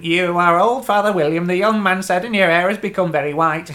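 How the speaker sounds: singsong Tweedle twins recitation; Tweedledum voice. Retold or imitated. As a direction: chant it like a Tweedle twin. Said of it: "the Tweedles, doing this little thing".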